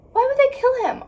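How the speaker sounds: high, loud wail of a question; upset cry. Real, not acted. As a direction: protest at the top of the voice. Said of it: "I'm so upset".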